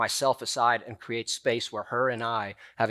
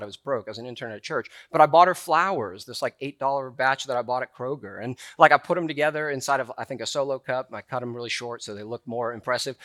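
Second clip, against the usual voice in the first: normal talking voice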